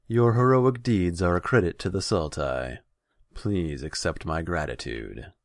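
bestow formal praise from the throne; pompous ruler granting thanks; lordly khan. A narrator's reading. Khan grandly praises his hero.

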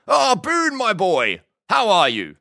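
put-on high-pitched, hearty old-boss greeting; jovial lord. A narrator's reading. Lord Huxley greets Boone by phone, jovial. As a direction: boom a delighted hello, pitched well up.

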